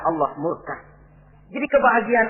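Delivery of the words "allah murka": low, soft drop on "murka"; hushed answer. Real, not acted. Preacher completing the warning on God's anger, lowered and soft. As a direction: let it fall quietly and gravely.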